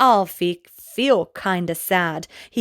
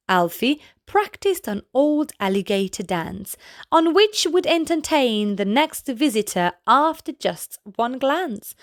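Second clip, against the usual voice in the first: ordinary delivery, nothing marked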